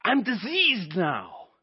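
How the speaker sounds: slow, stunned; dismayed Moses. Acted. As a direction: let the horror sink in slowly. Moses voiced after leprosy.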